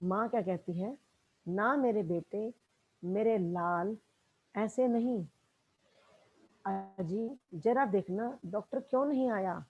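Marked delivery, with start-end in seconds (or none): 1.5-9.6 soft, tender mother soothing her son; loving comfort. Retold or imitated. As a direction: hush him gently, like a worried mother. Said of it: "अपने बच्चे को प्यार करते हुए कहती है". (बेटे को प्यार से, धीमी आवाज़ में दिलासा)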